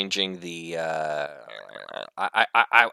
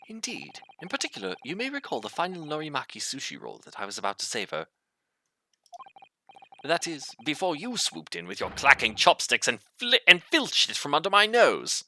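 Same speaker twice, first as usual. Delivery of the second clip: aggrieved Sholmes; 7 semitones higher, faster than usual. Acted. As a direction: build up to an indignant accusation. Voices aggrieved Sholmes recalling sushi theft.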